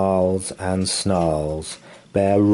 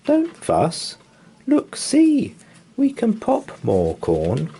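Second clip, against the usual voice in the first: very high tiny mouse voice; little mouse calming the bear, very high; squeaky mouse; 13 semitones higher than usual. Acted. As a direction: squeak it out soothingly. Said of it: "Mouse squeaks".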